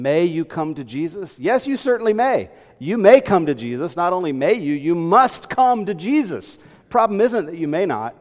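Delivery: loud, rising pulpit exhortation; building to a loud climactic appeal; full-force preaching. Acted. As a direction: preach it out to the congregation at full volume.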